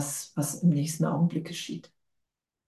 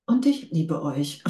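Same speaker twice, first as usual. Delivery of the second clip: normal talking voice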